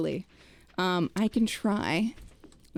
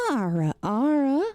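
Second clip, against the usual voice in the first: Southern accent; 3 dB louder than usual. Shown as a demonstration. Streamer granting Ferret's redeem, in a Southern accent. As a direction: lay on a hammed-up Southern accent. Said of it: "Southern accent Ara".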